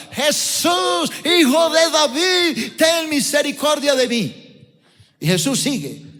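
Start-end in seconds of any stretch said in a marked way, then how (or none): 0.1-4.7 a blind beggar shouting high over a crowd, pleading; desperate plea shouted above the crowd; crying out. Retold or imitated. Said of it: "de pronto alguien grita".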